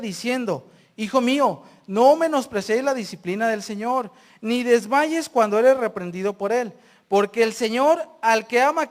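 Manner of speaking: loud, emphatic Bible reading; scripture reading. Acted. Preacher reading Hebrews to the congregation, loud and emphatic. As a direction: proclaim the verses at full voice.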